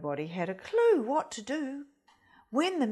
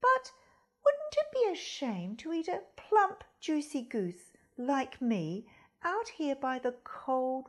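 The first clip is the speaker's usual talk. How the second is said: higher, coaxing goose; 4 semitones higher than usual. Acted. Goose coaxes the fox to carry her home, higher. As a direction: sweet-talk the fox into waiting. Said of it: "exclaimed the goose".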